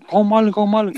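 lightly acted, worked-up accusation; retold fuss. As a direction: imitate a mother making a fuss. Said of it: "dia tuh heboh gitu".

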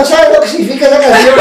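high, loud mock wail; tearful drunk friend. Drunk Gaby still crying about a song, mock wailing. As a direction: wail it like a weepy drunk, hammed-up. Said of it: "Y Gabriela todavía".

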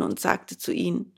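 ordinary delivery

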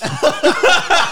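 loud, high burst of laughter; high laughing outburst. Real, not acted. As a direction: crack up at the memory. Laughing at own bluff story.

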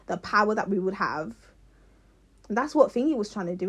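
ordinary talk, nothing marked

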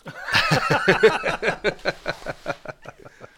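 high rising laugh, breaking into giggles; burst of laughter. Real, not acted. Laughing at the record shop guy's verdict on his voice, high rising laugh. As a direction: let a real high laugh ride up.

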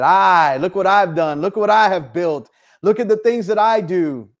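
boastful king. Retold or imitated. The preacher voices Nebuchadnezzar's proud boast, loud and lofty. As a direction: brag like a king admiring his kingdom. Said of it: "he said".

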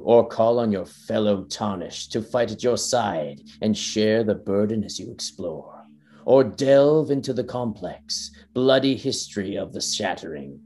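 grand, measured trailer voice, slowing into the last words; epic trailer narration. Acted. Podcaster reading the Elden Ring trailer aloud, epic narration. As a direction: narrate it like a fantasy game trailer.

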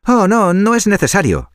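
high, sweet piglet voice; a sly little pig playing innocent. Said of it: "respondió el cerdito rosado".

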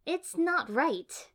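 high, loud, drawn-out protest; complaining. A narrator's reading. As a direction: whine at the injustice, slowly. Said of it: "Ino complained".

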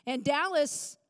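normal talking voice

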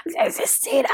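a hoarse, airless whisper like a damaged throat; raspy whisper. Pretend. Host mimicking an anti-smoking ad, raspy whisper. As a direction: croak the name out in a whisper. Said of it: "I sound like one of those anti-smoking commercials".

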